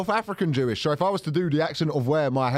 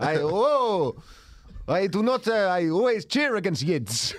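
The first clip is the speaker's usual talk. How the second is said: hammed-up Dracula-like accent, drawn-out words; exaggerated Eastern European-style accent; comic old-man accent; slower than usual. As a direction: protest in a mock thick foreign accent. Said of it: "I'll, I'll do the accent".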